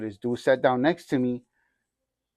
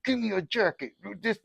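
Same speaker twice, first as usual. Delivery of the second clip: high-pitched mock threat; retold drunken threat, high-pitched; threatening demand; 9 semitones higher than usual. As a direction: imitate yourself making a threat, voice pitched way up. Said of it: "He said that I threatened him".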